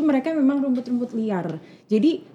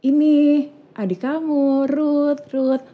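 gentle mother voice; 6 semitones higher, much slower than usual. Retold or imitated. Mother introducing baby to toddler.

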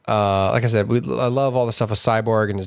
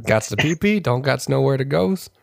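silly dumb voice. Pretend. Joking robot bladder bit.

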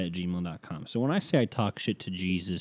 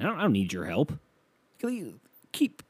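normal talking voice

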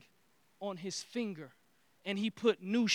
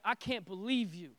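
furious; 4 semitones higher, 11 dB louder than usual. Acted. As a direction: hammer home every word of complaint. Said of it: "And he's furious".

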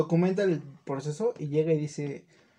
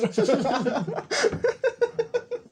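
burst of high laughter; 10 semitones higher than usual. Real, not acted. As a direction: crack up at the joke. Laughing at the cup.

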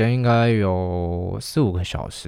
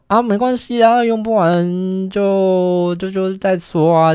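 higher, louder, drawn-out carefree mom; breezy mom; 11 semitones higher, 5 dB louder, slower than usual. Retold or imitated. Mom brushing off the extra plums, high and carefree. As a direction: play the unbothered mother, stretch it out. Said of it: "然后她就说".

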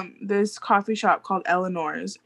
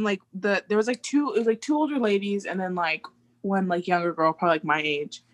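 ordinary talk

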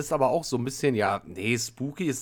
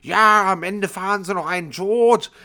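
much higher and louder, slow scolding shout; imitated shouting; 8 semitones higher, 7 dB louder, slower than usual. Woman on the street screaming at the driver, imitated loud shout. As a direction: bellow it like the ranting stranger. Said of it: "schreit die mich voll rum".

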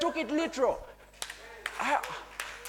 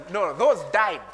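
usual speaking voice

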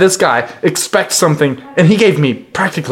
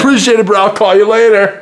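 mock bro; 6 semitones higher than usual. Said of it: "be like".

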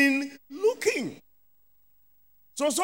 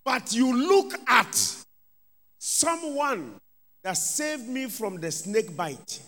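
usual speaking voice

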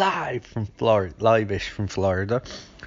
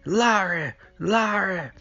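mock calling; 10 semitones higher, slower than usual. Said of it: "Loomis like".

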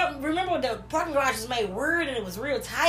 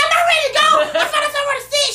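much higher and louder, whining protest; loud drunken protest; 14 semitones higher, 9 dB louder than usual. Retold or imitated. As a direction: refuse to leave, loud and high. Drunk refusal to leave club.

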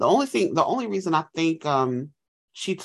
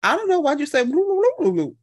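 high, loud mocking comeback; mocking retort; 13 semitones higher, 4 dB louder than usual. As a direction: fire his accent back at him, high and teasing. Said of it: "And she was like".

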